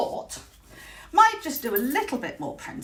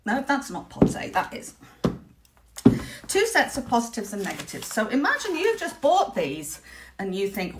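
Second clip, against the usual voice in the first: ordinary talk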